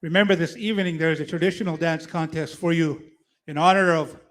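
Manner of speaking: loud arena announcer call; powwow emcee. Acted.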